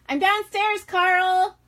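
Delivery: calling loudly downstairs for son; yelling across the room. Real, not acted. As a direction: shout out to the next room. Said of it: "I apologize for one second for what I'm about to do".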